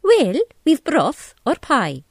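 a bustling wee Scottish woman, drawn out; Scottish landlady. A narrator's reading. Tea shop owner offers Carol broth or pie, high Scottish voice. Mrs MacDonald lists the menu.

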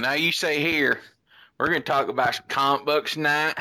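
a lightly put-on Australian accent, unhurried; hammed-up Australian podcast host; mock Australian accent. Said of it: "I started doing it in Australia".

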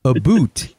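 mock Canadian accent, words set apart; bad Canadian accent. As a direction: exaggerate the Canadian vowels for laughs. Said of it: "bad Canadian accent".

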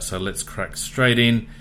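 normal talking voice

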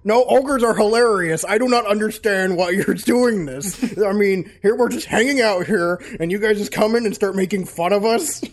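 offended ogre king. Acted. Ogre king scolds the players for mocking ogres, loud and indignant.

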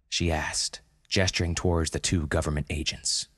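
ordinary delivery, nothing marked

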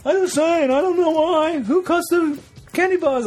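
high Seinfeld-style whine; bad Jerry Seinfeld bit. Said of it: "Jerry Seinfeld is the worst impression".

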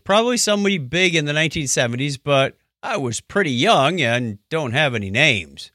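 usual speaking voice

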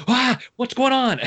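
high, slow startled cry of alarm; a hammed-up fright at the impossible; mock panic.

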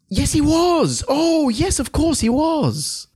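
high-pitched delighted gushing. Real, not acted. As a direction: gush in a high, excited voice. Gushing over admired actor's credits.

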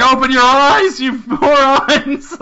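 high, loud exasperated shout, laughing through it; mock yelling Jon Snow. Jon Snow yelling at the doubters, loud and frantic. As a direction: yell at everyone to wake up. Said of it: "he's like".

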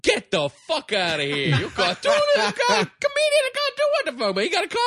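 very high squeaky Brooklyn-Southern voice; Brooklyn accent. Retold or imitated.

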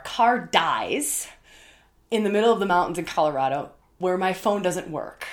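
ordinary talk, nothing marked

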